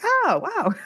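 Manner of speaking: high, mock "oh, wow" of surprise. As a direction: imitate their surprised gasp, pitched high. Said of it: "And they were like".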